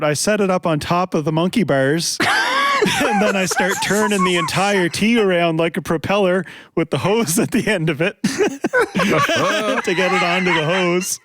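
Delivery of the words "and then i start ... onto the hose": laughing. Real, not acted. Laughing at his own antics.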